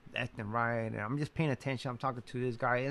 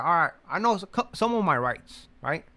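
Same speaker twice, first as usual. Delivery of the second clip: louder, higher mock bravado; worked-up boast; 5 semitones higher, 7 dB louder than usual. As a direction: puff up like you know your rights. Retells his defiant thoughts.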